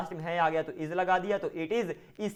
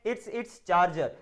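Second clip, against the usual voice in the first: "it's, it's charger" very slow; slow model answer; much slower than usual. Shown as a demonstration. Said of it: "यह इसका charger है". (बहुत धीरे "it's, it's charger")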